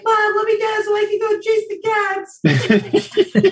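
high, slow, laughing pet voice; the dog Jovi begging mom in a high voice. Pretend. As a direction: squeal it out as the excited dog. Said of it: "he's like".

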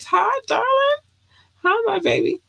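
squeaky high greeting to fans; gushing high-pitched love; high squeal. Real, not acted. Greeting goddaughter on screen.

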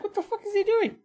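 high shocked outburst. Retold or imitated. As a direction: blurt it out in disbelief. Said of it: "I was like".

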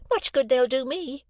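high-pitched tart retort; scornful sister. A narrator's reading. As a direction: toss it off in a high, grudging voice. Sister sneers at compliments.

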